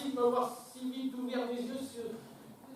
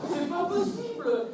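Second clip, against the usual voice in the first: shocked disbelief; 6 semitones higher, 6 dB louder than usual. Acted. Shocked reaction.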